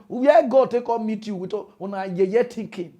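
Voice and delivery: usual speaking voice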